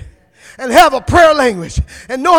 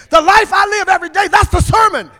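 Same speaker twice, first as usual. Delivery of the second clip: preaching at full force; 5 semitones higher than usual. Acted. Preacher shouting the sermon to the congregation. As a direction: drive the sermon home to the church.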